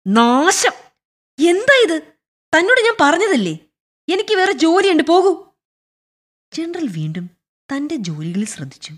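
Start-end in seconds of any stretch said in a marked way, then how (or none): 0.1-5.5 high, loud exasperated scolding; angry outburst. Acted. Busy general dismisses the pestering clerk, angry outburst. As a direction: snap loudly and send him packing.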